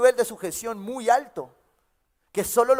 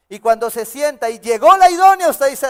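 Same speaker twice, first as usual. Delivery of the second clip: loud fervent preaching; 5 semitones higher, 10 dB louder than usual. Acted. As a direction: beg for words, full voice. Preaching, acting the single man's prayer.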